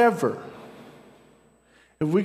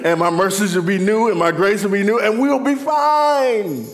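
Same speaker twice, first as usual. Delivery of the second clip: preaching, building; 2 semitones higher, 4 dB louder than usual. Real, not acted. Preacher promises the congregation new mercy each day, building fervently.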